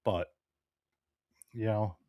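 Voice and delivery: ordinary talk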